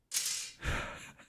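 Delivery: short breathy, voiceless laugh; hushed little laugh; whispered laugh. Real, not acted. Laughs at own joke while whispering.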